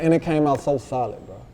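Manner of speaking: ordinary delivery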